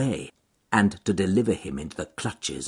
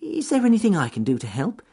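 cheerful rabbit voice; 12 semitones higher, faster than usual. Acted. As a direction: call up brightly to the trees. Rabbit offers help.

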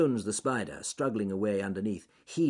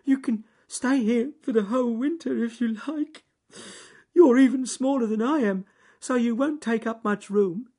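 high, loud, weepy rabbit voice; tearful; 9 dB louder than usual. A narrator's reading. Crying rabbit offering spider a home, tearful. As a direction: offer it through tears, high-pitched. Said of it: "who was crying himself by this time".

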